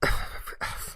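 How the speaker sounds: soft, reluctant exhale; resigned sigh. Retold or imitated. Firefighter giving in to a pushy woman, resigned sigh.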